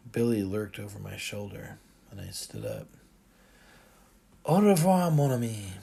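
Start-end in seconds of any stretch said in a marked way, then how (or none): 4.5-5.7 French. A narrator's reading. French farewell.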